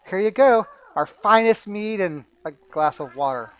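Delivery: loud, lifted-pitch tavern server; barmaid serving. Acted. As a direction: announce the drinks brightly and loudly as barmaid. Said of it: "she comes over and she says".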